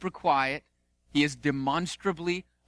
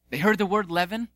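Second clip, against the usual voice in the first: usual speaking voice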